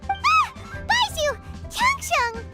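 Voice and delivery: high-pitched startled cry of names; startled exclamation. Acted. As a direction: caught out, gasp and blurt their names. Caught talking behind their backs.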